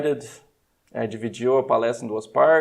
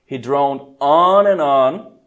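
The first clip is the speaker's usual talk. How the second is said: dragged out slowly and flat; slow drone; 6 dB louder, much slower than usual. Shown as a demonstration. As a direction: stretch it like a dull lecturer droning. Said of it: "falar em voz baixa de maneira chata".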